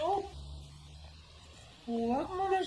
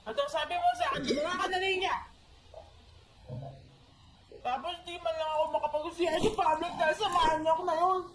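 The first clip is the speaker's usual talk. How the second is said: put-on tearful drunk rant; 6 semitones higher, faster than usual.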